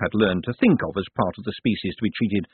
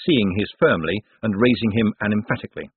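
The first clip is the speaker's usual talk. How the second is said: ordinary talk, nothing marked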